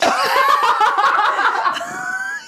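sudden high laugh; burst of laughter. Real, not acted. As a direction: crack up hard. Laughing at friend's outburst.

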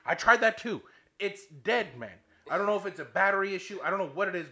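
loud, high, rapid-fire frustration; exasperated retort. Retold or imitated. Golfer answering the marshal's troubleshooting, exasperated. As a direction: insist heatedly, running out of patience.

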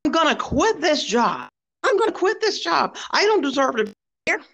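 imitating her sobbing rant, pitch rising to a shriek; mock hysterical crying. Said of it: "crying and screaming".

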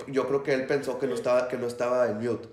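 normal talking voice

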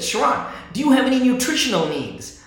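high, loud teasing question; wife mocking him with a pointed question; mock incredulous wife. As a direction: throw the absurd question back at him. Wife's punchline retold, exaggerated.

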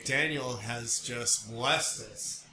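normal talking voice

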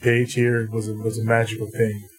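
normal talking voice

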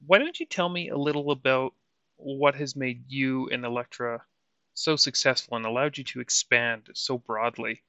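ordinary talk, nothing marked

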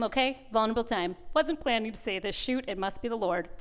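ordinary delivery

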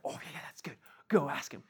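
hushed scheming whisper among friends; conspiratorial whisper. Acted. Pharisees urging a friend to trick Jesus, in a whisper. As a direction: whisper it like plotting behind Jesus's back. Plotters egging on their friend.

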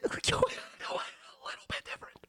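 breathy whisper, trailing off. Real, not acted. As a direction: drop into a whisper. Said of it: "I don't know why I did that".

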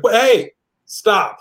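high, drawn-out parental warning. Retold or imitated. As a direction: imitate a parent finally losing it. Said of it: "you raised your voice at me".

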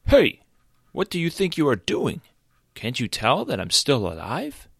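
high, loud alarmed cry, settling down; startled shout. Acted. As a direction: yell out in shock. Injured deer protests vulture.

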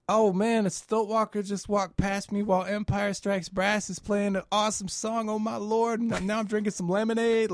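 high, wide-eyed amazement; awed excitement. Retold or imitated. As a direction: gush with childlike wonder. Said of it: "catching that same sense of wonder".

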